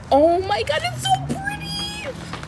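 very high, stretched-out squeak; silly squeal. Real, not acted. Plant lover gushing over a new plant, silly high squeal. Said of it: "stop talking like that".

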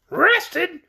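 high, shrill creature voice full of scorn; scornful, shrill. A narrator's reading. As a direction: squeal it indignantly as the offended orc. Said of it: "as scornfully as his shrill voice would allow".

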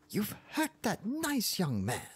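high, slow indignant wail; screamed reproach. A narrator's reading. Angry wife accusing her husband, shrill scream without much volume. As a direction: scold him high and drawn out. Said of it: "she screamed".

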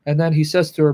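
ordinary talk

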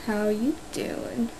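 slow, soft whispered greeting. Shown as a demonstration. Vlogger trying a whispered hello to viewers. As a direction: breathe it out slowly like a secret. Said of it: "Oh, I can't do that".

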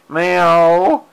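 high playful cat meow; mock meow. Meowing at her cat.

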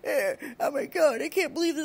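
high, whiny imitation of upset people; hammed-up, high-pitched indignation; mock pissed-off. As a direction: wail it in mock outrage. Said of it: "getting all pissed off and like".

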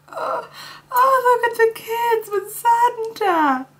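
high, slow, weepy voice of being overcome; imitating teary voice. Imitating herself welling up at kids with Santa. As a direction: squeak it out as if about to cry. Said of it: "And I was like".

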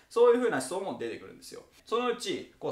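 usual speaking voice

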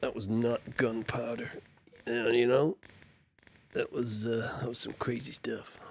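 stoned Guy Fawkes again, mild. Pretend. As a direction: play the spaced-out stoner. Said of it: "that wasn't gunpowder, man".

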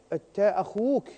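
ordinary talk

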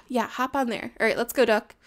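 usual speaking voice

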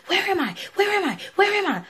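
high, frantic repeated cry; panicked. Retold or imitated. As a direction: imitate a girl panicking in hell. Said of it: "Instantly, she was like".

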